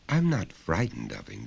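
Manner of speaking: put-on a child answering simply; small boy. Son answering his father, lightly voiced child.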